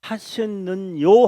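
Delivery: 하셨느뇨 slow, loud and high, rising at the end; questioning emphasis. Shown as a demonstration. Stresses the questioning verse ending.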